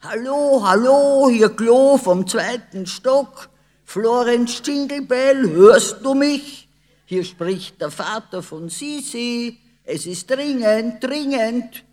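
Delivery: loud, high yelling into the bowl; father bellowing down the toilet, loud and high. A narrator's reading. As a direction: yell it down the toilet, urgent. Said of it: "der Vater brüllte in die Muschel".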